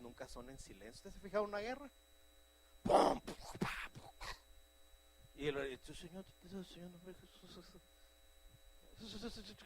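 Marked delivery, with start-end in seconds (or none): 2.8-4.1 loud mock explosion sounds; war noise bursts. Preacher imitating the sounds of war, loud bangs. 5.4-9.5 timid whispered prayer. Shown as a demonstration. Preacher mocking a timid, hushed intercession. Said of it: "eso parece guerra entre moscas".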